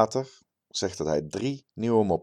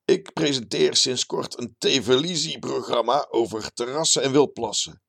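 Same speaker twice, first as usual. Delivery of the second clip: higher, louder show-off character voice; pleased-with-himself bragging man, pitched up; boastful terrace biologist; 6 semitones higher, 4 dB louder than usual. A narrator's reading. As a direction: brag like a puffed-up local celebrity. Character boasting about his TV show.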